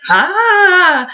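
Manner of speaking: high mock shriek of fright; a quick high mock scream; mock startled shriek. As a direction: squeal it like a fake scare. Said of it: "Anna was like".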